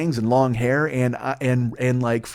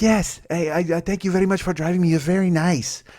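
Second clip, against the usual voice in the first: higher-pitched mock Mexican accent; heavy Mexican accent; 5 semitones higher than usual. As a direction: imitate a grateful passenger's thick Mexican accent. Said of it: "she had a really heavy accent".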